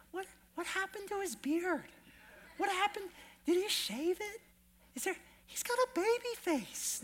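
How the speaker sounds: high mock-puzzled churchgoer voice; imitating puzzled churchgoer. Preacher voicing congregation's puzzled thoughts, high and mock-baffled. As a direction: play a baffled congregant noticing the missing beard. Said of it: "I know you were thinking".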